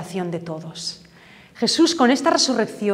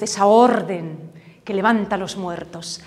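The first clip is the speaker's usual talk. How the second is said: ordinary talk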